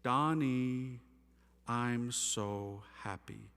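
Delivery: slow gentle whisper of a loving mother; loving maternal voice. Retold or imitated. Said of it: "The most feminine, maternal, loving voice".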